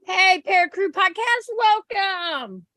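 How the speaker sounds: high, bright-spirited welcome; excited greeting. Real, not acted. Host greeting Para Crew Podcast, excited. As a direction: call out a welcome to the newcomer.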